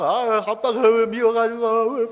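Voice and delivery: loud, high, slow blubbering wail; garbled crying child. Retold or imitated. Father imitates his daughter's tearful complaint, garbled mock bawling. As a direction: bawl the words until they blur, imitating a child. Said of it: "알아듣지 못하는 소리를 막".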